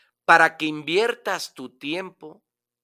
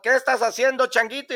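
high, curious animal voice; deer's voice; 10 semitones higher, 6 dB louder, faster than usual. Acted. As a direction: ask like a passing deer. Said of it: "pasa el venado".

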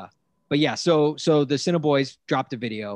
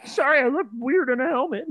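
high, loud sheepish apology; goofy Patrick Mahomes voice; 13 semitones higher, 7 dB louder than usual. Pretend. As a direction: apologize like a big dope. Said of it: "it's me, Patrick Mahomes".

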